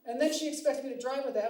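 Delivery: usual speaking voice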